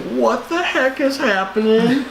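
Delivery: high, slow confused cry; mock bewilderment. A viewer in the theater, high slow bewilderment. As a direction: act baffled in the theater. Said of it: "you're like".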